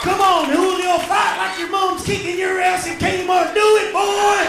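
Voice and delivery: high, very loud goading yell; urging shout. Acted. Yelling at a boy to run and fight, urging shout. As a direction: yell them on at full volume.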